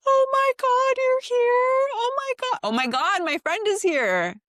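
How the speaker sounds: very high squealed yell; retold excited yell. Retelling the friend's surprise arrival, high squealed yell. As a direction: mimic a shrieking, overjoyed greeting. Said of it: "I hear her yell".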